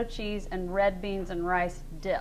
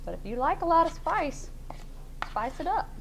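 sassy higher lift, teasing the viewers; playful tease; 8 semitones higher than usual. Real, not acted. Hostess teasing viewers who like heat, playful and sassy. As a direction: tease it up like a dare to the spice lovers. Host teasing spice lovers.

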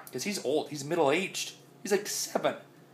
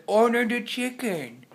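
voicing the dog; 8 semitones higher, slower than usual. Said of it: "He was like".

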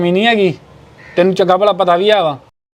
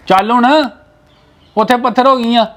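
higher, curt angry orders; 4 semitones higher than usual. Acted. Husband angrily orders wife to leave, angry. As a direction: snap at his wife to get moving. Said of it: "ਇੰਨੇ ਗੁੱਸੇ 'ਚ ਆ ਗਏ ਹੋ".

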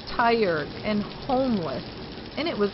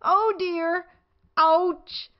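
rising alarm, higher and louder; squeezed and startled; 7 semitones higher, 4 dB louder, slower than usual. A narrator's reading. Rabbit squeezing into house.

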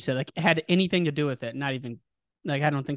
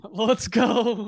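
higher-pitched, drawn-out rallying call; excited cheer; 5 semitones higher than usual. Real, not acted. Host reacting to the coach's rousing line, excited. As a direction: cheer it on, stretching the last word.

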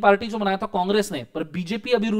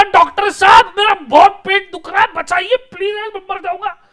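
high, loud whiny patient begging; pleading patient; 13 semitones higher, 11 dB louder than usual. Retold or imitated. Student acting a sick patient to the doctor, loud high whine. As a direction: beg the doctor like you're dying.

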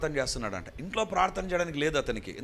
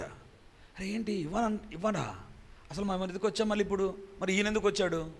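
softer, startled murmur of disbelief; shocked murmur; 4 semitones higher, 5 dB quieter than usual. Retold or imitated. Guests react to the withdrawn land gift, hushed and shocked. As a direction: mutter it as stunned guests, hushed.

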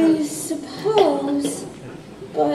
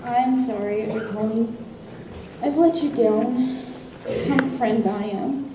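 sad; 4 semitones lower, 6 dB quieter than usual. Acted. Mocha apologizes sadly in skit.

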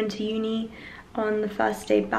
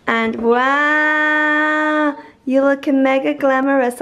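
higher, louder gushing wow; excited compliment; 4 semitones higher, 6 dB louder, much slower than usual. Real, not acted. To her mum, high loud excited compliment. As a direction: rave about how glamorous she looks.

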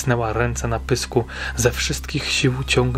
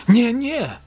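high, louder desperate cry; panicked protest; 12 semitones higher, 4 dB louder than usual. A narrator's reading. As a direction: cry out to stop her, much higher. June blocks the woman's way. (spanikowany, wysoki okrzyk sprzeciwu)

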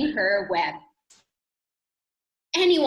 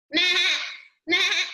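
short, higher goat bleats; goat bleating; 5 semitones higher than usual. A narrator's reading. Teacher voicing the goat in a story, goat bleats. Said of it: "said the goat".

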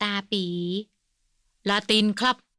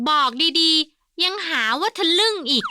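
high, loud, slow drawl; 7 semitones higher, 5 dB louder, slower than usual. A narrator's reading. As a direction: drag the words out cheekily. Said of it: "พูดยานคาง". (พูดยานคางแบบกวนๆ)

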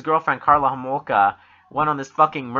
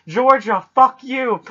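higher, louder mock yell across the border; 8 semitones higher, 6 dB louder, slower than usual. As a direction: hurl the insult south in fun. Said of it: "yelling all the way over".

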